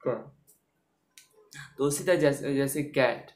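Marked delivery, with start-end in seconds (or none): 0.1-0.3 short lone "क" with a hard k; teacher's demonstration of "क"; C read as "क". Shown as a demonstration. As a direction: repeat the isolated k sound "क" for learners. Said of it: "क्या आता है".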